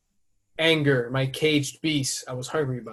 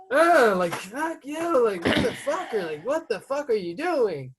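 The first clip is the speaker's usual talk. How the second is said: high, raised, cursing the driver who cut him off; furious at driver; 9 semitones higher, 4 dB louder than usual. Retold or imitated. Retelling road rage at a driver, high and angry. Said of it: "I'm like".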